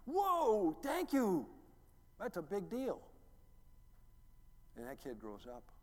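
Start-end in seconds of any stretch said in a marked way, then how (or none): 0.1-3.0 put-on very high, loud kid voice, amazed; excited kid. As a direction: squeal like a thrilled child. Voicing a grateful kid.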